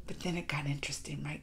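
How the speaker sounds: whispered, leaning in to share the twist. Real, not acted. Viewer hooked on the series, whispering the plot twist to the audience. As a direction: drop to a secret whisper.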